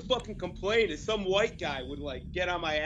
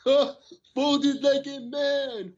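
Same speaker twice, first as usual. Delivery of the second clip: high, loud, very slow put-on voice; hammed-up high slow cousin impression; imitating cousin; 10 semitones higher, 6 dB louder, much slower than usual. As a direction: drag out the ad line like his cousin. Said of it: "He's like".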